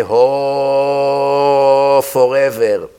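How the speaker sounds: mock lament. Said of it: "Then you say".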